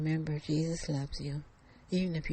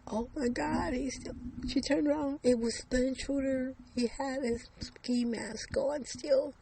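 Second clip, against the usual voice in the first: sudden fright; 9 semitones higher, 4 dB louder than usual. A narrator's reading.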